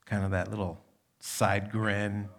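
ordinary talk

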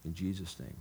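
soft, earnest praying voice; closing prayer. Acted. Pastor prays over the congregation, softly earnest. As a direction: pray it gently over the people.

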